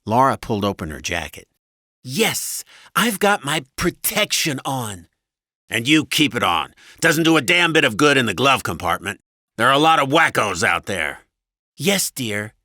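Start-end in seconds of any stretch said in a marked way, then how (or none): 2.0-5.1 high, slow wifely compliance; high-voiced, fed-up wife; exasperated wife. A narrator's reading. 5.7-11.2 loud, irate husband, pitched up; loud, exasperated husband's tirade; angry husband. A narrator's reading. 11.8-12.5 lightly voiced dutiful wife, pitched up; a hint of resigned wife; placating wife. A narrator's reading.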